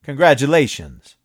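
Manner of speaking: loud sarcastic congratulations; dry retort. A narrator's reading. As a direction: jab from across the tables.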